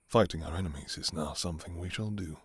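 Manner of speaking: normal talking voice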